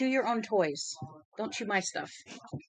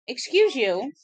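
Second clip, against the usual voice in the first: loud high reproach; half-joking telling-off to the dog; mock scold; 6 semitones higher, 10 dB louder, slower than usual. Real, not acted. Owner telling off her dog, loud mock reproach. Dog did something rude.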